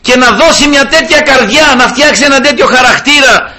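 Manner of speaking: high, loud fervent sermon voice; impassioned preaching. Acted. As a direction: preach it with rising fervour. Preaching at full force. (κήρυγμα με πάθος)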